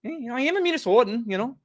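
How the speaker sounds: mock Canadian accent, high and chirpy. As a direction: ham up a Canadian accent, apologetic and folksy. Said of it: "I can do a little Canadian, eh?".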